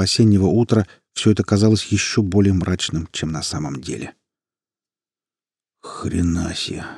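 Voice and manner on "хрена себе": quiet. A narrator's reading. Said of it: "произнёс призрак негромко".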